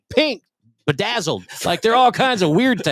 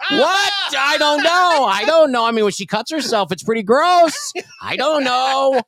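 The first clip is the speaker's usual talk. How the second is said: put-on high, flustered boy rushing the denials; a boy caught off guard by the store clerk, panicky and high; panicked kid; 8 semitones higher than usual. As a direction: squeak out the excuses like a scared ten-year-old.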